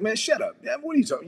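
high, exasperated interruption as the father; high-pitched fatherly protest; father brushing it off. Retold or imitated. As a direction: cut him off, incredulous. Father cuts off son's apology.

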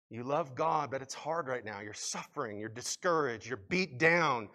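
ordinary delivery

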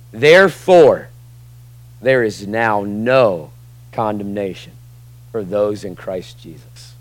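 therefore punched loud, then a slow fading; verse read with weight. Shown as a demonstration. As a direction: savor each word, slow and fading. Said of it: "the greatest therefore in the Bible".

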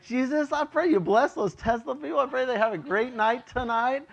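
high, lightly pious prayer voice; mock sweet prayer. Pastor retells praying for the Tesla drivers instead, lightly pious. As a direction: pray sweetly with a wink. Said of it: "It's like".

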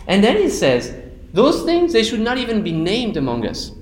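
preaching hard against greed and impurity; fervent preaching. Acted. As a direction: drive the point home from the pulpit. Preacher builds to fervent climax.

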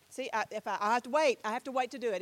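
ordinary talk, nothing marked

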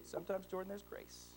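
soft, nearly whispered grace; whispering wisdom; hushed wisdom. Retold or imitated. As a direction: let the last word fall to a hush. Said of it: "dad's quiet wisdom".

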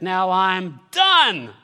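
loud, drawn-out triumphant cry; mock victory shout; triumphant announcement. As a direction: proclaim it big, stretching the words. Acting out being finished with grief.

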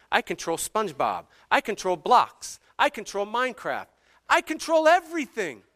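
high, emphatic list building to a peak; mock-tyrant dad, pitch climbing on each claim; hammed-up insistence, punching every "I control"; controlling-dad rant. Acts out his controlling-dad rant.

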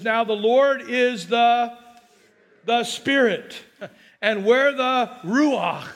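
slow, rising, loud preaching build; preaching at full force. Acted. Pastor preaching the verse to the congregation, slow and rising. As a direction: drive the verse home, slowing and climbing. Scripture climax.

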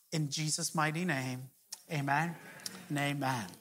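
low, soft praying voice; hushed closing prayer over the congregation. Acted. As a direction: pray it quietly and reverently.

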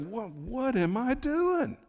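high, drawn-out mock-panicked realisation; sudden panic. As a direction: squeal it slowly as the doubt hits, acted. Peter suddenly doubts on the water.

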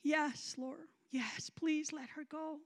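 soft, slow entreaty; small, drawn-out plea; hushed pleading. Retold or imitated. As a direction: plead quietly and humbly. Softened answer, pleading with God.

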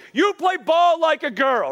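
put-on high, slow kid's taunt, strange voice; a lightly hammed-up schoolyard jeer. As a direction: sneer it like a catcher on the field. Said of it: "But it was a big deal".

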